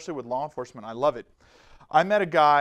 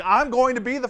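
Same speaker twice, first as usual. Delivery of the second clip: excited; 8 semitones higher, 7 dB louder than usual. Retold or imitated. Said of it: "putting her whole body".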